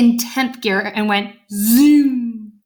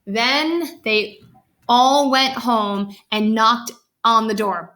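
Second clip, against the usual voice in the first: ordinary delivery, nothing marked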